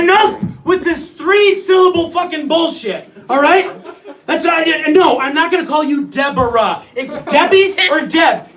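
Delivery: loud, high-pitched incredulous arguing; hammed-up indignant tirade; outraged rant. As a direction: rail at them in comic disbelief. Acted rant about long names.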